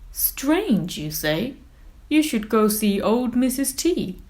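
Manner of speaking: booming jolly whale, laughing then talking; big whale voice. Acted. Big Blue reassuring little Squiddy, jolly and loud. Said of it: "says Big Blue".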